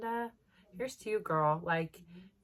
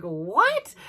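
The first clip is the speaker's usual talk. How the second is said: surprised; 9 dB louder than usual. Retold or imitated. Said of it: "And I'm like".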